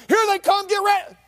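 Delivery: high shouted alert; warning shout. Retold or imitated. Lookouts warning the camp that men are coming, shouting. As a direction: yell the warning. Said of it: "they say".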